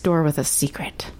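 playful hushed whisper; secretive whisper. Pretend. Teasing self-description, whispered.

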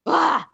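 loud, high mock yell of anger; short physical cry of frustration; demonstrated angry outburst. Shown as a demonstration. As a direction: let anger burst out as a demo. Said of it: "like, it's physical".

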